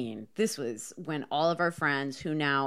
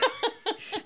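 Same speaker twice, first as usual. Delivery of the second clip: high laugh; 5 dB quieter than usual. Real, not acted. Laughing at own fall.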